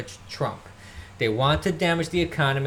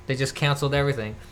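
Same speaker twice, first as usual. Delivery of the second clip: ordinary talk, nothing marked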